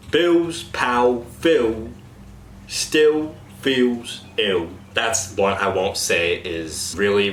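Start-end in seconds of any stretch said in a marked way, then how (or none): 0.1-4.7 slow Cockney "Bill's pal Phil still feels ill" with vowelled L; drawn-out Cockney, L sounds swallowed; Cockney example sentence. Shown as a demonstration. Coach demos Cockney L sentence to cast. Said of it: "Example sentence".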